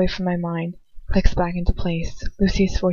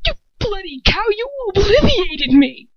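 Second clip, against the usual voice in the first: furious shout; 13 semitones higher, 3 dB louder than usual. A narrator's reading. Girl screaming at Lucius over her stolen memory. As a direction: scream the insult at him.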